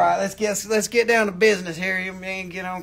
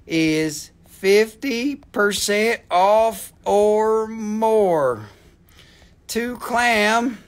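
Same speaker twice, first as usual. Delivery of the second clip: very slow, word-by-word sale announcement; slow, stretched-out proclamation; deliberate announcement; much slower than usual. Pretend. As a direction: draw out each word with pauses between.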